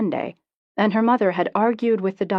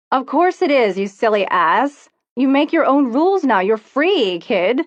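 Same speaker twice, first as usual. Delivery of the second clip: louder and higher, thrilled; excited cheer; 6 semitones higher, 6 dB louder than usual. Acted. Friend on the phone celebrating Amy's new freedom, excited. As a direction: cheer her on like a jailbreak.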